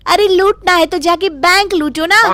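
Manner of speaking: the wife refuses to be fooled; shocked haggling wife. Acted. Sudha haggling, shocked at price. (भाव सुनकर चौंकी, ऊँची तकरार)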